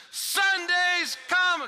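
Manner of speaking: high, slow shouted answer; crowd shout. Retold or imitated. Congregation shouting back in unison, slow. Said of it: "everybody shouted back in unison".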